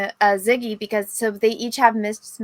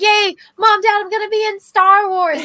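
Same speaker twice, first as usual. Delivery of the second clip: high, loud squeal of joy to the parents; overjoyed young actress; 11 semitones higher, 6 dB louder than usual. Pretend. New actress telling Mom and Dad, squealing with joy. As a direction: gush like you just got cast.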